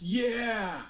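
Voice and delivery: sudden high, loud yes; cheering a lucky save; excited cheer. Real, not acted. Big save cheer at table.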